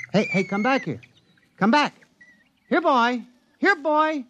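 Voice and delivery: rising calls after a runaway pet. Acted. Calling runaway creature, rising.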